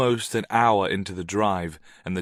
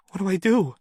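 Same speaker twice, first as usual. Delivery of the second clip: frightened; 10 semitones higher, 4 dB louder, faster than usual. A narrator's reading. Frightened woman asks.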